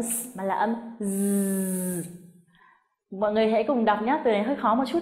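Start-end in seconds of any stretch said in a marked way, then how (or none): 1.0-2.0 a long, buzzed "z"; voiced z sound. Shown as a demonstration. Teacher contrasting it with s, drawn-out buzzing "z". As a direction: hold the "z" as a steady voiced buzz. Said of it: "âm cuối của nó không phải là âm".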